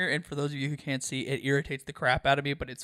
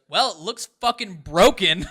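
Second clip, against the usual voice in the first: louder and slow, a flat matter-of-fact verdict; blunt retold verdict; 5 semitones higher, 9 dB louder than usual. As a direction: deliver it like a nurse's blunt diagnosis. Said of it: "grabs his face and goes".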